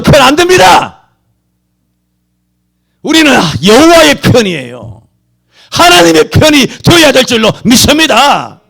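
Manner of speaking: impassioned pulpit shout; fiery preaching. Acted. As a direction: proclaim it to the congregation. Preacher declares whose side.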